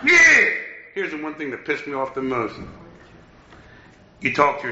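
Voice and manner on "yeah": high, loud yell into the phone; loud high shout of frustration. Retold or imitated. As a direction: shout his name at him. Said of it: "why are you yelling at me".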